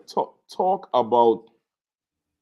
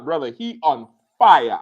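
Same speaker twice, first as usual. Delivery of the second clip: ordinary delivery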